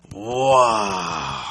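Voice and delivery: loud, drawn-out wow; amazed wow. Real, not acted. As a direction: let it out in awe. Amazed at experience gain.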